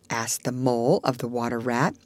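plain reading voice

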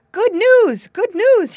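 high, loud proclamation of news; excited cry. A narrator's reading. Fox calling up to the roosting cock, excited cry. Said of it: "he cried".

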